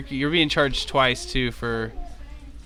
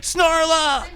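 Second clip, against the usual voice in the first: high, loud melodramatic shouting; hammy hero in shrieking outrage; outraged cry; 5 dB louder, much slower than usual. Acted. Companion confronts whoever grapples his darling, indignant high shout. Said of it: "of course, he's like".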